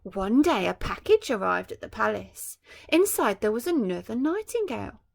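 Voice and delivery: bright storybook reading voice; story narration. A narrator's reading. Parent reading a picture book to a child, storytelling. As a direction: read it like a bedtime picture book.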